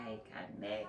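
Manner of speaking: drained labour whisper; whispered reenactment. Retold or imitated. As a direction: whisper it like you're spent from pushing. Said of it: "I'm, like, out of breath".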